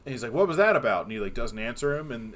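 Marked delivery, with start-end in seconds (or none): 0.3-1.0 higher, louder puzzled question; mock-alarmed driver demanding an explanation; retold startled demand. Driver questions his passenger after speeding past a car, startled.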